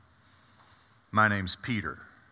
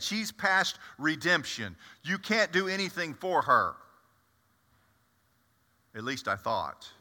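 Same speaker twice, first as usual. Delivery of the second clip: ordinary delivery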